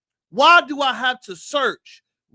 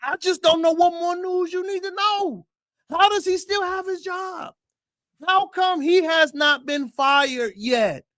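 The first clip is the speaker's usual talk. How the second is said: outraged exclamation; 8 semitones higher than usual. Real, not acted. Host to viewers, asking why the coach kept his job, in outrage. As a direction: demand answers in exasperation.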